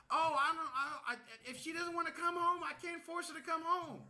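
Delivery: high, stammering mom voice; flustered mom. Retold or imitated. Voices the mom making excuses.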